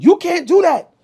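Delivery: high, loud cashier voice; imitating the cashier's scream; mock screaming lady. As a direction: squeal it like the outraged cashier. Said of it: "just started screaming".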